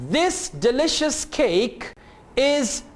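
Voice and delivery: slow, careful word-by-word model reading; read-along model. Shown as a demonstration. Teacher has learners read sentences along, slow and over-articulated. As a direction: read slowly with gaps so learners can follow. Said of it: "इन sentences को गौर से सुने और मेरे साथ पढ़ें।".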